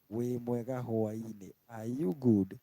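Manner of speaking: usual speaking voice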